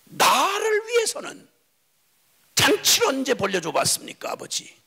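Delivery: 높고 느리게 터뜨리는 항의; 서운함을 아버지께 쏘아붙이듯; 분노한 형. Acted. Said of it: "이 자의 분노가". (아버지에게 따지는 형의 높고 느린 분노)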